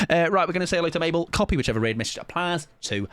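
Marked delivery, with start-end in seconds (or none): none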